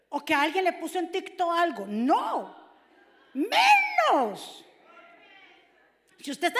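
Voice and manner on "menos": grita de golpe, mucho más agudo y fuerte; grito enfático. Real, not acted. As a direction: suelta la palabra con un grito de predicador. Predicador enfatiza a gritos. (grito enfático)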